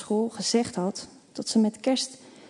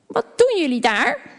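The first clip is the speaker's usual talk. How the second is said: put-on old neighbour woman; 7 semitones higher, 5 dB louder than usual. A narrator's reading. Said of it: "vraagt ze dan".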